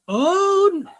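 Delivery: high, loud alarmed cry. Retold or imitated. Storyteller reliving the punch, high panicked oh no. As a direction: yelp it as your fist flies. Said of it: "And I was like".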